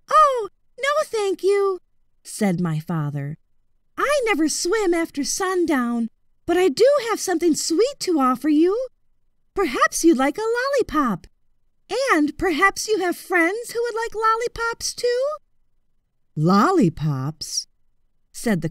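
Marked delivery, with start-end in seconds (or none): 0.1-1.8 put-on higher, polite boyish voice; high, courteous young voice; boy's high voice. 4.0-15.2 put-on higher, polite boyish voice; high, courteous young voice; boy's high voice. 16.4-17.7 low, slow, interested crocodile. Acted.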